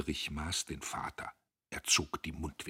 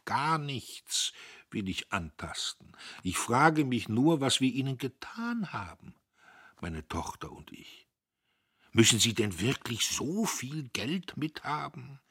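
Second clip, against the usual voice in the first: a broken father asking why; fending off; 7 semitones higher, 3 dB louder than usual. Acted. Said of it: "Göppel wehrte ab". (leicht gekränkt und bittend)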